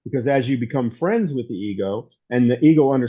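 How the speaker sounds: normal talking voice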